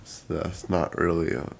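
normal talking voice

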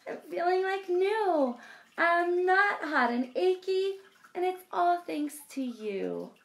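joyful cry. Acted. Bear thanking friends and wanting to play, excited and high. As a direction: cheer like you're finally well. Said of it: "Bear cries".

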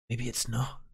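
a creepy hushed aside; ominous hush. Acted. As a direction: drop the voice low and quiet, ominous. Ominous afterthought after shrugging it off.

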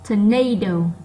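NA in "tornado" punched loud; stressed second syllable. Shown as a demonstration. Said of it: "nó to hơn những âm còn lại". (nhấn mạnh âm hai của "tornado")

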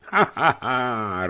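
high acted burst of laughter. A narrator's reading. Porfirij hears the pig dream, bursting out laughing. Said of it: "rozesmál se Porfirij".

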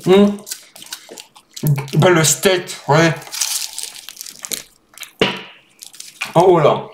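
talking between bites; chewing while talking. Real, not acted. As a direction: mouth partly full of food. Seen 1: small webcam; wrap held at mouth, eating around the words.

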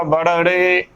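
ਬੜੜੇ with b and doubled flap; wrong pronunciation. Shown as a demonstration. Teacher showing the common slip, mispronunciation. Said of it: "ਗਲਤ ਉਚਾਰਨ ਹੋਵੇਗਾ".

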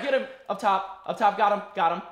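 loud excited combat yells; shouting callouts. Real, not acted. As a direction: yell the kill out loud. Live firefight callouts.

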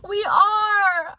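loud, very high squeal; excited squeal. Real, not acted. To friend in a matching sweatshirt, excited squeal. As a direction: shriek it with delight.

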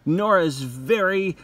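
ordinary talk, nothing marked

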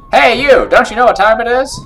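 high, loud challenge; calling out. Acted. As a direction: holler at a kid out late. Voices character calling out to player.

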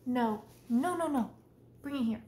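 loud firm commands to a pet; commanding the cat. Real, not acted. Taking something from the cat, loud commands. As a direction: make the cat drop it and praise it.

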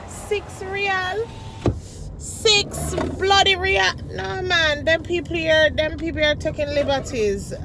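loud, high, slow outrage; indignant rant. Real, not acted. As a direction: vent about being overcharged. Ranting about the price.

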